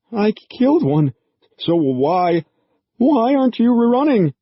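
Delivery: high, loud terrified cry; terrified shout at the creatures; frantic panic. A narrator's reading. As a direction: plead in rising terror. Kelly panics before the creatures.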